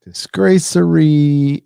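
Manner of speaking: loud scornful. Real, not acted. Scorn at the episode's writer.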